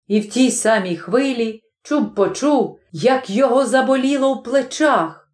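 plain reading voice

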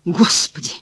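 voiceless, rolling-eyes whisper; exasperated whisper. A narrator's reading. Cleaner despairing of the publisher, exasperated whisper. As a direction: breathe it out in despair.

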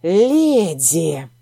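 hissed low and menacing; hissing. A narrator's reading. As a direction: hiss the word like a threat. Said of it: "прошипел".

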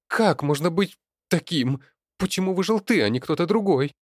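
loud, high-pitched outburst; furious accusation. A narrator's reading. Daughter blames Leonid for surviving, loud and furious. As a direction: hurl the questions with rising anguish.

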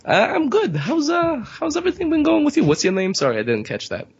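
chirpy put-on New Yorker; New York character.

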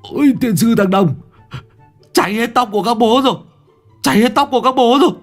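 high, fast, loud shrieking; wretched scream. Acted. Workers screaming as their hair burns. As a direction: shriek in panic over burning hair. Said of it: "hét thảm".

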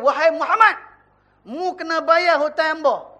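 a creditor demanding his debt in Kelantan Malay; Kelantan accent. Retold or imitated. A creditor demands his debt from Muhammad, in Kelantan accent. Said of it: "dia tak cakap bahasa Kelantan lah".

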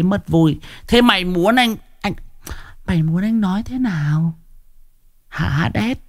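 high-pitched, aggrieved pleading; exasperated protest. Real, not acted. Streamer pressing a long-time follower on what he should say, exasperated. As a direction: push the question out high, in frustration. Keeps challenging the complaining viewer.

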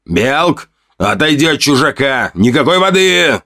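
high-pitched, commanding call; barked order. A narrator's reading. Hunter orders shaman away from the stranger, barking. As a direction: bark it out, urgent and forbidding. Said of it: "рявкнул Рант, увидев это".

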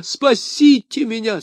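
high, slow, pleading cry; высокая отчаянная мольба; desperate plea. A narrator's reading. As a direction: beg for rescue at the top of the voice. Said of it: "возопил он".

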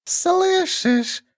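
high, slow creature hiss; thin high whisper-like question; thin whistling voice. A narrator's reading. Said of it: "тоненький, свистящий".

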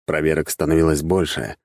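usual speaking voice

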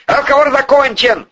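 high, forceful shutdown; heated, high-pitched final word; angry dismissal. A narrator's reading. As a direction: slam the discussion shut. Boss angrily ends the argument.

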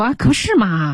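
acted agreement, lifted in pitch; Rachel agreeing. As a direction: lightly act out going along with someone. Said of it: "Rachel就说".

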